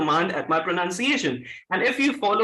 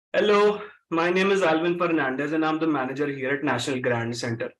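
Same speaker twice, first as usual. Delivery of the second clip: low Punjabi-accented English with vibrating R's; 6 semitones lower than usual. Shown as a demonstration. Trainer demonstrates the same sentence to a class, Punjabi accent. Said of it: "let's say I'm a Punjabi".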